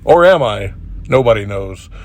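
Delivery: ordinary talk, nothing marked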